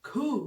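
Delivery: खू ending in h; German Q. Shown as a demonstration. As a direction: let खू end with breath. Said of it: "इसके एंड में ह की आवाज आए". (खू के अंत में ह)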